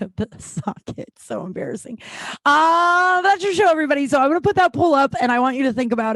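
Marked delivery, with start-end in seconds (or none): 2.4-6.2 much higher, louder show wrap-up; big announcer-style outro; loud sign-off. Real, not acted. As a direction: belt the closing line to the room. Loud sign-off after mic knock.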